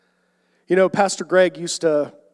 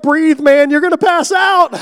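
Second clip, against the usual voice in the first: much higher and louder, shouting at a laughing friend; mock shout; 12 semitones higher, 12 dB louder than usual. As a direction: yell it as if he might faint. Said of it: "I'm like".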